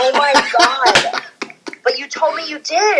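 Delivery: slow, high, stunned oh my God; shocked disbelief. Retold or imitated. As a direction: drag it out in horrified disbelief. Learning the soup wasn't paid.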